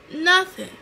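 nothing jumps high and loud in distress; almost shout the last word in alarm; desperate cry. Real, not acted. Telling viewers she went blind, a high loud nothing.

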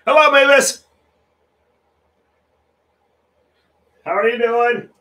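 loud, high-pitched hello; calling out. Real, not acted. Greeting someone who has just turned up, loudly calling out. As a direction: call a greeting across at full volume.

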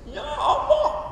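very high, drawn-out fake wail; mock tearful plea. Young preacher testing whether he can make people cry, mock tearful plea. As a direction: wail it slowly, hammed-up tearful.

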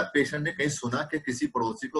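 ordinary delivery

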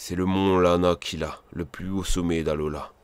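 low, hushed storyteller voice; solemn game narration. Acted. Narrator presenting the majestic mountain to the player, solemn. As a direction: introduce the mountain in a deep, softened register. Reads out the game's mountain introduction.

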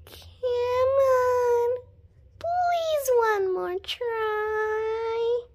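high, drawn-out begging; slow wheedle with stretched words; pleading. Acted.

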